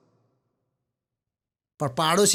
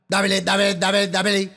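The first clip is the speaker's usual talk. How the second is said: vendor hawking. Retold or imitated. Vendors hawk on the train.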